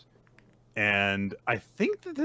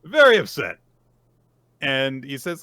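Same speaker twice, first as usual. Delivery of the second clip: usual speaking voice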